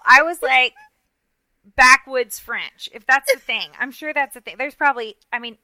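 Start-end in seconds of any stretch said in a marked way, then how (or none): 1.8-2.9 slow, drawn-out comic label; mock disgust. Real, not acted. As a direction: stretch out each word for comic scorn. Names her accent with mock disgust.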